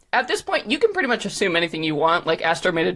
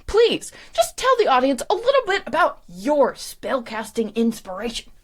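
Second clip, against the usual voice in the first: lightly voiced game-show host; show announcer; 6 semitones higher than usual. Host interviewing the round's winner, showy. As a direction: coax the winner with a showy lilt.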